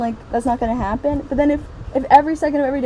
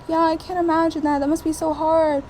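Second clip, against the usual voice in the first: higher, hollow sympathetic parody; mock sympathy; 6 semitones higher than usual. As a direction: send up a hollow condolence. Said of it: "they're all like".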